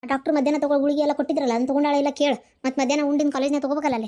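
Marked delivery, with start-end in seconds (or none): none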